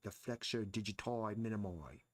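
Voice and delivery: a bad Australian accent, digiti turned into "dutoi"; mock Australian accent with a rise at the end; terrible Australian accent. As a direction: imitate an Australian classmate saying "The flexor digiti minimi", badly. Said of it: "That was a terrible accent".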